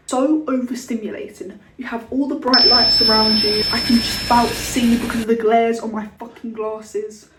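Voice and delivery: usual speaking voice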